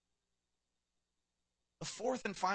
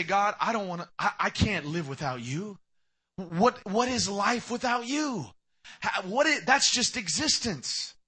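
loud, higher, slow impassioned plea; pleading to God; 5 semitones higher, 6 dB louder than usual. Retold or imitated. A sinner begging God not to leave him, loud slow plea. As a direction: plead as if life depends on it. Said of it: "than to say".

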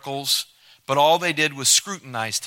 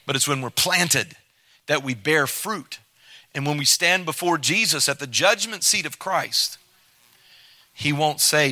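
ordinary talk

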